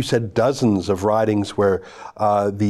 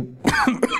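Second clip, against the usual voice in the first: coughing; 12 semitones higher, 3 dB quieter than usual. Real, not acted. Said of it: "I'm coughing".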